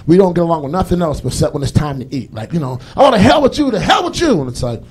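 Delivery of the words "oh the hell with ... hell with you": high, louder mock yell; a high hammed-up squabble between the couple; mock argument. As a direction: snap at your partner in jest. Couple arguing, acted.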